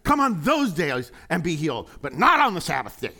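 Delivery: loud, high, grumbling church elder; grumpy old elder. Retold or imitated. Preacher mocking a grumpy church elder, loud grumble. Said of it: "sound like a grumpy old elder".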